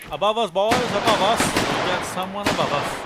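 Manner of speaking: playful battlefield shout; calling out. Pretend. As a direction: alert the troops to an enemy above. Calling warning to troops in game.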